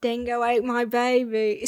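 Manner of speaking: put-on Aussie catchphrase voice; mock Australian accent. Shown as a demonstration. Topping co-host's catchphrase, mock Australian accent. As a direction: play up the Australian vowels. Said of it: "What's your best accent, Australian accent".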